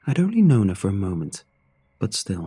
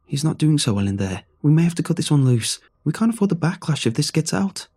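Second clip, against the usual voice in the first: loud, higher heated argument; arguing; 7 semitones higher, 4 dB louder than usual. Acted. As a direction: push back hard in the quarrel. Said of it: "Henry and the man arguing".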